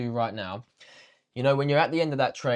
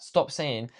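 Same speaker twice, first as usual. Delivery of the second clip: ordinary talk, nothing marked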